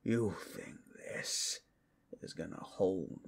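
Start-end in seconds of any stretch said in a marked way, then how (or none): none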